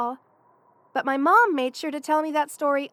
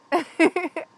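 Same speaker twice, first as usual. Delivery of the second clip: short, loud giggle; fond chuckle; 3 semitones higher, 5 dB louder than usual. Acted. Remembering superhero cartoons with a best friend, fond giggle. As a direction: laugh at a shared old memory. Reminiscing about childhood cartoons.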